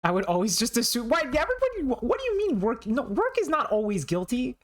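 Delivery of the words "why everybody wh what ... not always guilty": mock indignation.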